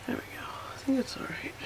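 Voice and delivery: unvoiced whisper to herself while fixing the mic; quick private whisper; whispered aside. Real, not acted. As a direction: breathe it out under your breath.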